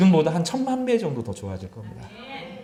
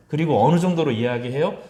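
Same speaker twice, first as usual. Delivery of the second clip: usual speaking voice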